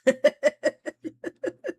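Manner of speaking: bright cackle up high; loud high laugh. Real, not acted. Streamer laughing at Fallen's joke, high and loud. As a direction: burst out laughing.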